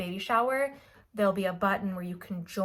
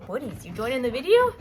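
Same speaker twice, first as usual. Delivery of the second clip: louder, higher playful call to the pet; 4 semitones higher, 7 dB louder than usual. Real, not acted. Vlogger breaks off to ask her pet Woodies, playful louder call. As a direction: pop the question brightly at the animal, louder. Calls out to pet mid-video.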